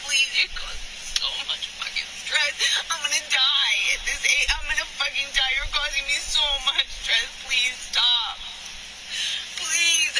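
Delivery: real distress, begging her to stop; anguished begging. Real, not acted. Partner overwhelmed by stress.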